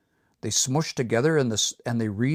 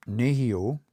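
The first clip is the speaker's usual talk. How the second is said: nehio with the first syllable, ne, stressed; 3 dB louder than usual. Shown as a demonstration. Teacher shows how to say nehio, first-syllable stress. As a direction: model nehio clearly, leaning on ne. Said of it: "So the way you would say this".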